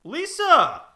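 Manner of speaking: high, loud eager greeting; excited shout. Acted. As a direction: call her name out with delight. Excitedly calling out to Lisa.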